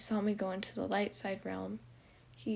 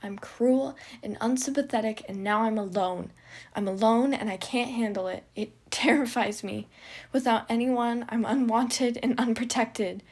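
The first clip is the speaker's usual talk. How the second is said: loud, high sobbing confession; tearful outburst; 3 semitones higher, 10 dB louder than usual. Acted. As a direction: pour it out through tears.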